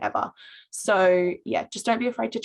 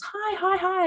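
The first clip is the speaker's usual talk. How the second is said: much higher, bubbly retold hello; excited girl greeting; 12 semitones higher than usual. Girl greeting a stranger on campus, high excited chirp. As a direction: imitate an eager girl chirping hi. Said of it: "this girl comes up to me and goes".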